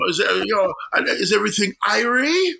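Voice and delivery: high, hammed-up fake patois; bad Jamaican accent. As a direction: overdo a tourist's Jamaican. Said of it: "watered down Irish pirate version".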